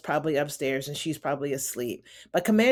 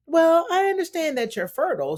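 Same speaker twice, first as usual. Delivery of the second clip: high, pitched-up indignant retort; a hammed-up offended wife snapping back; mock indignant wife; 10 semitones higher than usual. Wife retorting to husband, high and indignant. Wife argues in retold scene.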